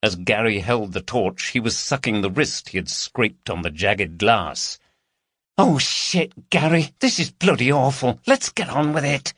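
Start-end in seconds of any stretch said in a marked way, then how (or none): 5.6-9.3 high, anxious accomplice voice; nervous Carol. A narrator's reading. Carol to Gary inside the dark house, nervous. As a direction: fret at your partner, pitched high.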